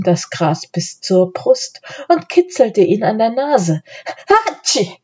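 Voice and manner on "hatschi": high, spoken mock sneeze; acted sneeze. A narrator's reading. Grass tickles Philipp's nose, acted sneeze. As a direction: build into a pretend sneeze. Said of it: "machte er".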